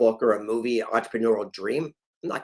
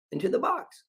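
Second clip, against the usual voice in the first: into the box, voice jumping high; high lilting reveal; high rising punchline; 13 semitones higher than usual. Shown as a demonstration. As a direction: toss it up high and bright like a reveal. Punchline of savings routine.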